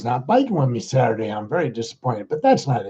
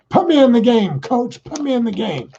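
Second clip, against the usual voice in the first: eager plea; 7 semitones higher than usual. Pretend. Begging coach to play.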